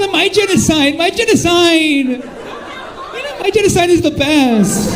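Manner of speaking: high, drawn-out mock shout; mock childish boasting. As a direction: shout it high like a bragging kid, hammed-up. Mocking someone boasting of their genocide.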